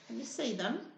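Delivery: plain reading voice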